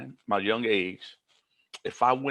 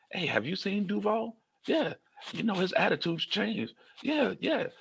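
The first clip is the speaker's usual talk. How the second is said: gossiping staff voice; 10 semitones higher than usual. Retold or imitated.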